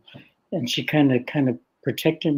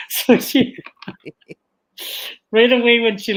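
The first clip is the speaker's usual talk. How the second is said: laughing; 9 semitones higher, 6 dB louder than usual. Real, not acted. Laughing at the memory.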